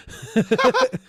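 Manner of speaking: squealing laugh burst; high squeal laugh. Real, not acted. Laughing at own joke.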